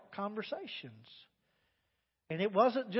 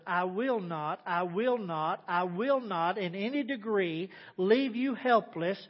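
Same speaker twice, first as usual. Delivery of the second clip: scripture reading; 6 dB louder than usual. Acted. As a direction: read the promise with rising force. Reading the Amplified with force.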